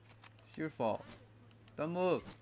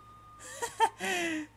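burst of laughter. Real, not acted. Laughing at teammate kill.

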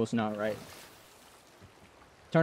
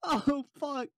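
pained groaning; 6 semitones higher, much slower than usual. Real, not acted. Sat on himself painfully.

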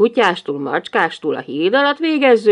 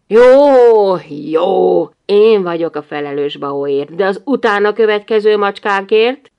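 irritated; 6 dB louder than usual. Acted. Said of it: "milyen ingerültek a férfiak".

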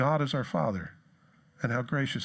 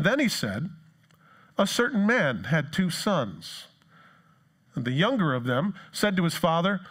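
scripture reading; 4 semitones higher than usual. Acted. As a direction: read the verses deliberately aloud.

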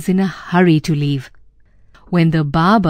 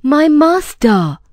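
slow, higher appeal; crying out; 7 semitones higher, slower than usual. A narrator's reading. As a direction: cry out in drawn-out protest, moderately. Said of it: "cried the barber".

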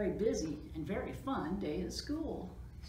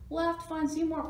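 high, louder storybook cry; 9 semitones higher, 6 dB louder than usual. A narrator's reading. Splat urging Spike to find a lost friend, crying out. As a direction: cry it out with urgency. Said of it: "cried Splat".

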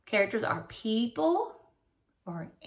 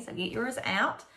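normal talking voice

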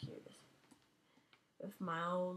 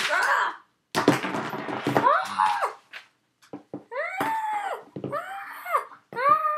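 shrill rising screams of Ah; string of piercing yells; high screams; 10 dB louder than usual. Real, not acted. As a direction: scream again and again as you fall. Game character dying, screaming.

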